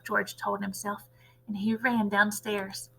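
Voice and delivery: ordinary delivery, nothing marked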